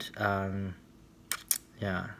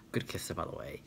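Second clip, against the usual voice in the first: whispered aside; 5 semitones lower, 10 dB quieter, faster than usual. Real, not acted.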